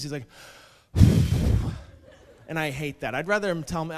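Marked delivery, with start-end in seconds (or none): 0.9-1.8 loud, brisk huff of breath; imitated exasperated sigh; impatient exhale. Customer fed up waiting for his latte, imitated huff. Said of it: "he gave me one of these".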